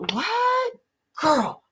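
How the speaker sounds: put-on high gasping what then girl; shocked gasp. Showing how she reacts to a friend's story, high gasp. Acting her reaction to stories.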